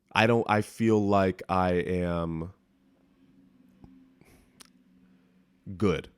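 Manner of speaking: a long loaded pause before "good"; pregnant pause. Real, not acted. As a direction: hold the pause, then land on "good". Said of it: "Did you hear that pregnant pause?".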